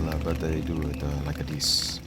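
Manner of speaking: low, soft mock Italian accent; a quick sample of an Italian lilt; lightly put-on Italian accent; Italian accent demo. Shown as a demonstration. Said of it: "I can't do it very well".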